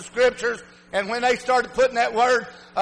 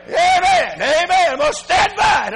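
very high shouted amen, then fervent vow; impassioned preaching; 10 semitones higher, 6 dB louder than usual. Real, not acted. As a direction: cry out amen to the congregation. Preaches at full force.

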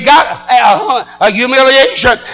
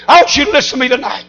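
normal talking voice

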